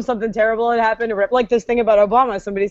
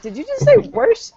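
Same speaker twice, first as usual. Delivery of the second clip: high, slow incredulous question; mock disbelief; 12 semitones higher, slower than usual. Reacting to hearing warsh, mock disbelief. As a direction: draw it out, scandalized.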